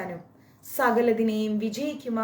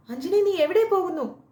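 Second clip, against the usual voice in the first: much higher, loud cry of disbelief; anguished cry; 11 semitones higher, 7 dB louder than usual. Acted. Kesari cries out to his departing wife, loud and high. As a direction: cry out her name in shock.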